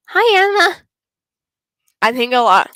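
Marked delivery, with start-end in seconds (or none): 0.1-0.8 a much higher, louder character voice; cheery club-room greeting voiced for a game character.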